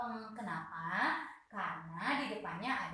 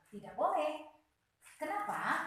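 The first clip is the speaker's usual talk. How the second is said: ordinary talk, nothing marked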